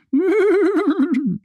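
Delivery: high imitated neigh falling away; whinny back like a playful foal. A narrator's reading. Foals answering Annie, high imitated whinny. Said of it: "Wiehern sie zurück".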